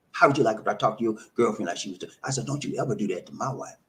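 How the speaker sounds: low, quick, contained retold warning; firm, controlled warning. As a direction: stand your ground without raising your voice. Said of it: "you talk to them from a position of strength".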